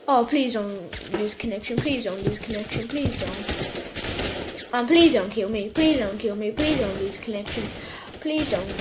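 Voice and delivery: soft, low, repeated begging; rapid little muttered pleas; hushed pleading. Real, not acted. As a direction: plead quietly under your breath. Begging the game not to drop.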